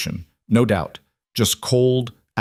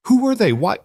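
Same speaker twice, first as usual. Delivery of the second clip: high, loud panicky anger; panicked anger; 13 semitones higher, 3 dB louder than usual. A narrator's reading. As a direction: fear flaring into anger. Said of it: "Ella's voice rose, panic sharpening into anger".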